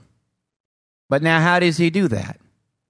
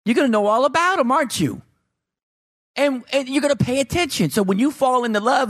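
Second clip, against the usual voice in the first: pitched-up eager preaching; high fervor; 9 semitones higher than usual. Acted. Pastor pressing the congregation, voice lifted high. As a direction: drive it up high and bright.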